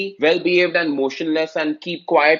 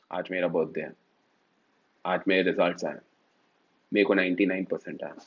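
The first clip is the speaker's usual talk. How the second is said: lower, softer, expressionless monotone; deadpan poker face; 10 semitones lower, 8 dB quieter, much faster than usual. Shown as a demonstration. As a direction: say good news with zero feeling. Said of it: "like एकदम ऐसे".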